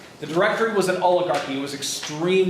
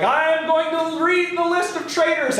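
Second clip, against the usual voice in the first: high, sustained orator's announcement; mock proclamation; 10 semitones higher than usual. Robespierre announcing to the convention, mock-grand proclamation. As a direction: declaim it grandly, pitched way up.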